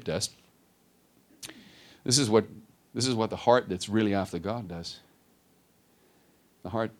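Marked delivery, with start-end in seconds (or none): none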